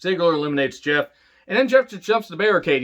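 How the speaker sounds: ordinary talk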